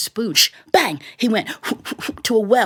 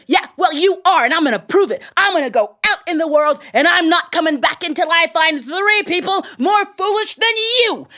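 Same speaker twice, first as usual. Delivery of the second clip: higher, heated vow building to the end; angry tailor; 7 semitones higher than usual. A narrator's reading. Tailor vowing to find bigger fools, angry and higher. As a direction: storm off with a furious promise. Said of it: "And the tailor was so angry".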